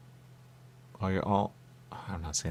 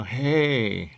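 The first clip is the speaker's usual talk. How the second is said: drawn-out, louder, higher "hey"; imitated greeting; 4 semitones higher, 5 dB louder than usual. Parent bringing in their daughter, imitated long greeting. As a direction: stretch out a mock greeting. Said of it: "they bring in their daughter and they go".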